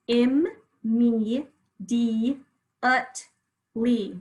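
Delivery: Im, me, di, ut, li with pauses between; each of Im, me, di, ut, li separate and firm; sounded out. Shown as a demonstration. Teacher sounds out a tough word by syllables, sounded out. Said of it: "let's sound out by syllables".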